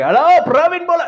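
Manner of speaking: put-on high, loud Indian-accented phone greeting; excited uncle calling, thick Indian accent. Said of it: "sorry, excuse the accent".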